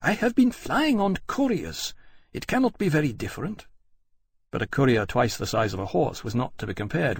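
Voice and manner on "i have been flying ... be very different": queen. A narrator's reading. Queen continuing.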